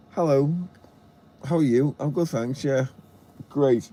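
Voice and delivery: chirpy put-on high voice for a mock chat; cartoon princess. Mimicking a small-talk exchange at the charger.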